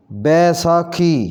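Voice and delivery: बैसाखी opening on a full 'bai'; correct pronunciation. Shown as a demonstration. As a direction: say बैसाखी as 'bai-saa-khee'. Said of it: "इसका सही तलफ़ुज़ होगा". (बैसाखी का 'बै' पूरा बोलें)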